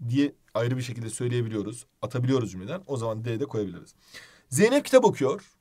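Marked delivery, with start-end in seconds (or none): 4.5-5.5 example raised and louder; example read aloud. Shown as a demonstration. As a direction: read the sample out for the class. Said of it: "diyebiliyoruz ayrı". (örnek cümleyi yüksek sesle okuma)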